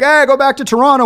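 high jeering call; mock taunt from opposing fans; hostile fan. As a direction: jeer like a home crowd fan. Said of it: "people were like".

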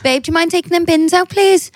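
high sweetly pleading wife; mock wife request. Wife performs the request again on demand, high sweet ask. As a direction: ask nicely in a lifted voice. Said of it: "Go on, do me again".

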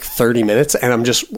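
ordinary delivery

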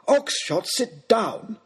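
high, angry lordly cry; outraged aristocrat's command; exasperated order. Acted. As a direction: call him to heel, fed up. Lord orders the baronet again.

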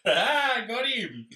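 laughing. Real, not acted. Teasing banter about a noogie.

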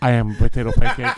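loud, fast, laughing declaration. Pretend. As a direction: announce it proudly through laughter. Mock-solemn answer, laughing.

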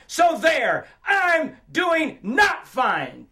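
high, loud outburst of pent-up confession; full-voiced, exasperated yell. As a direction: shout it at a silent room, defiant and fed up. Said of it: "So I yell out".

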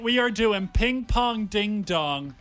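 normal talking voice

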